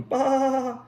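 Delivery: high, thin bleating baa; plaintive sheep call. Acted. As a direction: bleat like a little lost sheep. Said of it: "came faintly from the mountain".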